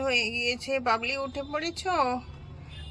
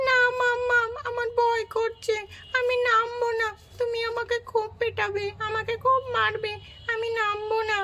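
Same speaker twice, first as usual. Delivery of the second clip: put-on child pleading from the roof; scared child; 7 semitones higher than usual. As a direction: plead with mum not to hit you. Frightened child refusing.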